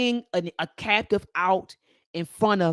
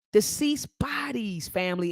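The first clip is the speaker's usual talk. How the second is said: usual speaking voice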